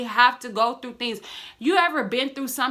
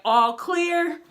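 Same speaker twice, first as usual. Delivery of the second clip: ride operator call; 6 semitones higher than usual. Retold or imitated. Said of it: "it's like".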